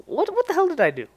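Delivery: high, outraged protest; indignant disbelief. Real, not acted. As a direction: shoot back in shock. Defends himself, indignant.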